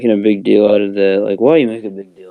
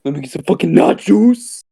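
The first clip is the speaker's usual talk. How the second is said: higher cartoon order voice; hammed-up fast-food ordering bit; exaggerated Spanish-accent order; mock Taco Bell order; 8 semitones higher than usual.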